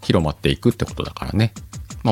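usual speaking voice

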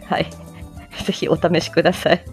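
ordinary talk

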